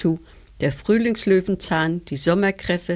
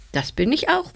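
eingeworfen; 8 semitones higher than usual. A narrator's reading. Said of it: "warf der Löwenzahn ein".

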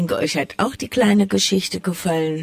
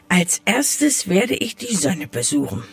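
plain reading voice

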